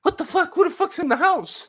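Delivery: high, alarmed cousin voice; imitating cousin. Cousin tells how he woke to voices, high and alarmed. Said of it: "he's like".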